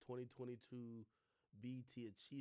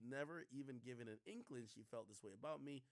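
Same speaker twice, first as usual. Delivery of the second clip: normal talking voice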